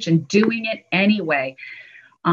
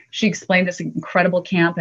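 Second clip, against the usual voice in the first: ordinary delivery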